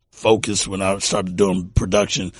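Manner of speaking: normal talking voice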